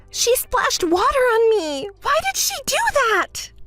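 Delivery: high, climbing outraged complaint; aggrieved, high-pitched protest; indignant whine. Acted. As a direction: wail at the injustice of it. Complains again of being splashed.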